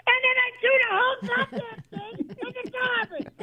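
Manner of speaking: high-pitched crying aunt voice; aunt's high wail. Retold or imitated. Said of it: "I go".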